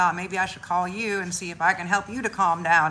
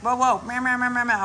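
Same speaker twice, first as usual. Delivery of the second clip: higher, louder mock meows; meowing cat; 5 semitones higher, 4 dB louder than usual. Cat meows at her owner about the stove, mock meowing. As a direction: play the cat nagging for attention. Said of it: "she'll be like".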